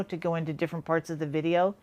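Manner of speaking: ordinary talk, nothing marked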